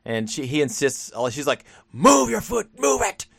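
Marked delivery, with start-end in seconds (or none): 1.9-3.2 high-pitched mock yell; imitating a doctor yelling at a patient; retold yelling. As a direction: bark it as an insistent doctor's order. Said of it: "just starts yelling at her".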